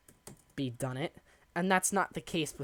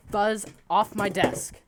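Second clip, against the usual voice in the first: higher and louder, real alarm; alarmed shout at cat; 5 semitones higher, 8 dB louder than usual. Real, not acted. Streamer yelling at his cat knocking over his drink, alarmed. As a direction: yell at the cat as the drink spills.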